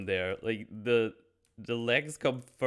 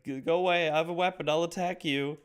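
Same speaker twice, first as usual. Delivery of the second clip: higher, shaky-sounding mock threat; mock scared threat; 6 semitones higher, faster than usual. Geppetto waving a hatchet at Pinocchio, mock scared. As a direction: warn it off while frightened.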